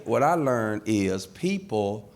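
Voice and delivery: usual speaking voice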